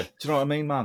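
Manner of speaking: put-on Mancunian rock-star voice. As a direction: parody a swaggering Manchester rocker. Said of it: "So if Jon Snow was [name]".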